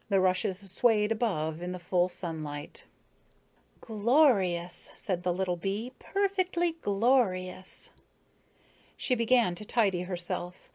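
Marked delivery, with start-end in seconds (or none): 3.8-4.9 dreamy little bee sighing. A narrator's reading. Bee admiring the pond. 6.0-7.9 slow, dreamy, higher bee voice. A narrator's reading. Bee admiring the pond.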